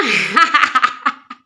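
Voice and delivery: hearty real laugh; loud laugh. Real, not acted. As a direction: burst out laughing. Laughs at own joke. (malakas na tawa)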